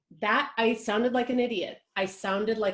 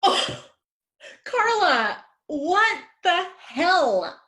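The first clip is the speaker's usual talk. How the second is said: stunned disbelief; 9 semitones higher, 5 dB louder, much slower than usual. Acted. Shocked at being cast.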